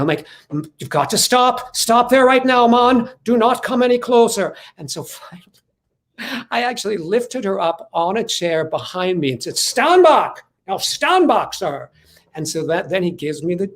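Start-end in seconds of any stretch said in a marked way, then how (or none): 0.5-4.5 louder, higher firm order to stop; commanding warning. Retold or imitated. Protecting the woman from the fish chaser, a louder firm warning. 9.6-11.9 heroic command. Retold or imitated. Lifting her onto chair, ordering him.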